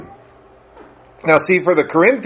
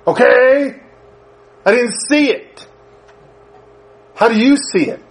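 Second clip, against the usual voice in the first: pleading son; 7 semitones higher than usual. Retold or imitated. Son pushing his mother for answers, high and baffled. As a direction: ask her how she knows. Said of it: "son pressing mother".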